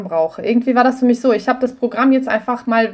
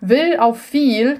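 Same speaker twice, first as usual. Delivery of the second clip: "will auf viel" drawn out slowly, each word apart; slow rhyme demo; 3 semitones higher, much slower than usual. Shown as a demonstration. Explaining German rhymes to a guest, slow demo. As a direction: spell out the failed rhyme slowly. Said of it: "kannst du nicht reimen".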